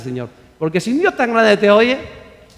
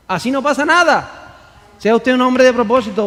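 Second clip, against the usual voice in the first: ordinary talk, nothing marked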